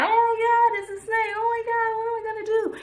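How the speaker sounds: high, panicked squeal at the snake; frightened shriek of a man seeing a snake; scared Moses. Retold or imitated. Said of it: "My man's Moses gets scared".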